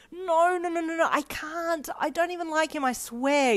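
very high-pitched panicky protest; flustered denial. Acted. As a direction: deny it frantically, high in the voice. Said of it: "you lied".

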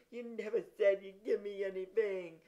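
put-on tearful little boy's protest; whiny, weepy child's voice; sputtering. A narrator's reading. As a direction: sputter it out while crying. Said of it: "he sputtered".

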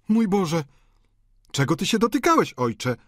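high, dismayed outcry; loud, high-pitched daughter's exclamation; Anastazja exclaiming. A narrator's reading. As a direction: cry out at your father in a high voice. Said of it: "zawołała Anastazja".

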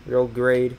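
GRANDE said as "grade"; one-syllable GRANDE, like "grade"; mispronounced GRANDE. Real, not acted. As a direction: say GRANDE to rhyme with "trade". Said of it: "forget how to pronounce Grande".

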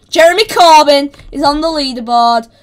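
loud, proud shout; big celebratory holler; triumphant announcement. Real, not acted. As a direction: proclaim your win to everyone. Announcing leaderboard success.

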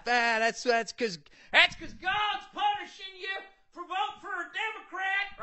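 high, shrieking mock voter voice; hammed-up gloating shout; a mocking red voter yells that God punishes blue voters. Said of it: "all the red people are gonna laugh".

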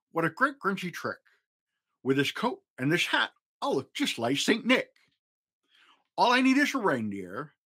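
chuckling Grinch. Acted. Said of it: "he chuckled and he clucked".

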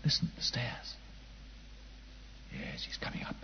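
hushed whisper, breath only. Acted. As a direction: whisper so the intruder can't hear.

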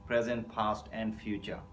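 usual speaking voice

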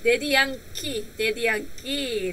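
usual speaking voice

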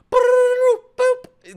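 high, loud imitated game sound; imitated beep. Imitating life points popping up, high mock beep.

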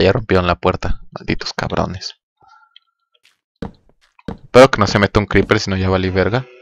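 ordinary delivery

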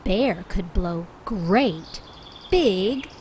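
gentle picture-book narration; reading to children. A narrator's reading. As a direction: read it like bedtime to little ones.

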